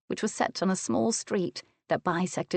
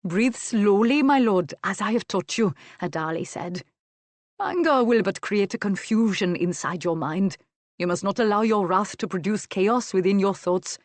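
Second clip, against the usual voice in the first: put-on accented adviser's voice, slightly raised and firm; Adali, measured counsellor; 2 semitones higher, 3 dB louder than usual. A narrator's reading. Servant steadies his angry lord, measured counsel. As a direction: counsel the lord with deliberate care.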